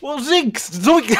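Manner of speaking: Shaggy impression. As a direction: try Shaggy but crack up. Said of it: "you're really getting in there".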